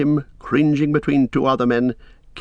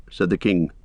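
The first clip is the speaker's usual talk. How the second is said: ordinary delivery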